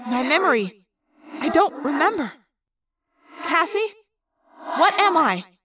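echoing, halting inner voice; confused thought-speak. A narrator's reading. Amnesiac Rachel questioning her friend, echoing confusion. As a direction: grope for it with echo, in pauses.

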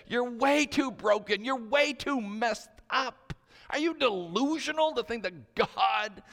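high, loud accusing tirade; shouted condemnation. Acted. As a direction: hurl each accusation at the listener. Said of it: "those voices of condemnation".

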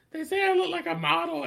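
put-on high little girl voice; a girlish high-pitched boast; daughter voice. Daughter quoted.

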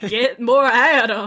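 loud, slow southern blowhard; Foghorn Leghorn voice. Retold or imitated. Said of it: "Foghorn Leghorn ass".

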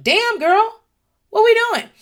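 high, loud incredulous call-out; mock astonishment. Reacting to a big tub of candy, mock astonished. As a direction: react with exaggerated, high-pitched disbelief.

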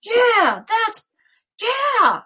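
loud, high-pitched, mounting yeah; hammed-up yeah, getting carried away; escalating agreement. Speaker acts out being swept up by a thought, escalating agreement. Acted agreement building out of control.

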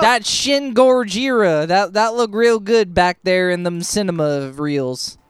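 a light mock southern accent; southern drawl. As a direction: lean into a hammed-up southern accent. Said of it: "a southern twang".

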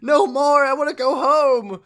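high, loud, desperate screaming; terrified pleading. Retold or imitated. Terrified man fleeing the cult, screaming high and loud. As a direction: beg to be let go, shrieking. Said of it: "a terrified man runs out".

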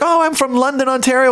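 light tourist voice. Retold or imitated. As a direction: chirp your hometown brightly. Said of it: "He's like".

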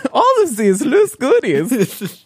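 slow, high Swedish lilt while laughing; Swedish accent. Pretend. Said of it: "Swedish accent".

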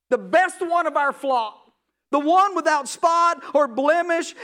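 impassioned preaching father; fervent father. Acted. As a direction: proclaim it with full conviction to your son. Father tells son of the lamb's blood.

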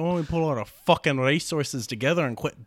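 fast, cursing Scottish sailor; brisk hammed-up Scottish accent. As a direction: rattle it off like a fed-up Scot. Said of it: "'cause he's Scottish".

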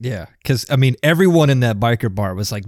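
ordinary delivery

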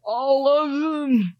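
put-on very old man. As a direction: insist like a cranky old clerk. GM voices old clerk.

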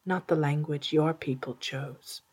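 ordinary delivery, nothing marked

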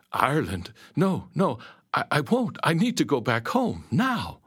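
high, stammering refusal; panicked protest. A narrator's reading. Captive told of Ireland begs to go home, panicked. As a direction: plead urgently, pitch climbing. Kidnapped narrator protests to pirate.

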